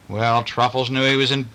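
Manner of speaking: usual speaking voice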